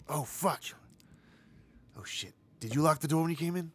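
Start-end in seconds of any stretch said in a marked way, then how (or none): 0.1-2.3 panicked breathy whisper. Acted. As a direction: curse under your breath.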